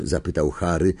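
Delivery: ordinary delivery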